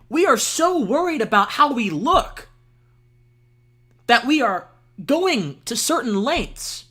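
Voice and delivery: high, slow, mock-philosophical musing; mock pensive musing. Host musing to cohosts in a mock pensive, high, slow voice. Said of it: "Let's be pensive about this".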